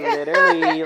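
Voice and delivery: high, loud mock Valley girl, laughing; imitated Valley girl drawl. Co-host echoing her friend's drawn-out words, laughing Valley girl imitation. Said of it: "you prolong your words".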